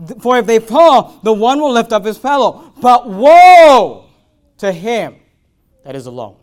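booming, slow scripture reading at full force; loud preaching of scripture. Acted. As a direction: thunder the verse to the congregation. Preacher reads scripture aloud.

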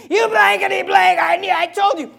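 high, loud ranting shout; mock furious yelling. Mocked parent cursing out Billy, yelling. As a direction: yell like a parent who has snapped. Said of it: "you start yelling and cursing".

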